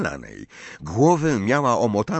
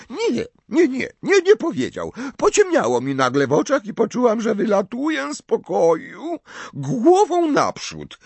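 Professor Trelawney; 13 semitones higher, 3 dB louder than usual. Acted. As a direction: play the tipsy, offended seer, pitched way up.

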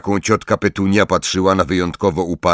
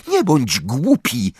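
slow, higher-pitched rebuke; mildly irritated scolding; snappish; 6 semitones higher, slower than usual. A narrator's reading. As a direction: snap at a silly friend. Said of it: "warknęła".

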